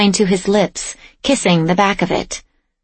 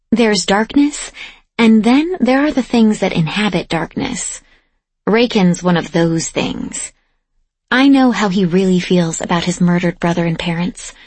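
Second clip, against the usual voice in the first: ordinary delivery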